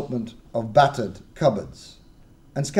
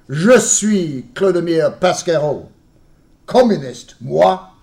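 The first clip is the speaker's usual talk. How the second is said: put-on loud, slow, high proud French-accented boast; proud French declaration; 5 semitones higher, 9 dB louder, slower than usual. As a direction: announce yourself with fist raised. Fierce welcome, raising fist.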